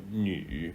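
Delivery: 女 low, as with a frog in the throat; frog-in-throat third tone. Shown as a demonstration. Teacher showing the third tone, low croaky 女. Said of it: "you've got a frog in your throat".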